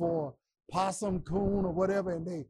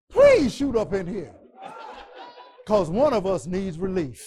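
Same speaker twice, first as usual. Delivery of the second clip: loud laughing shout, begging; laughing plea; 7 dB louder than usual. Retold or imitated. Hunter in the tree begging his friends, loud laughing shout. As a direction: beg through a laugh at full voice.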